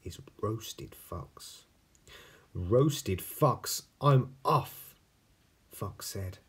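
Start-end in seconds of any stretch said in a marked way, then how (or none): 2.5-5.0 fox voice. Acted.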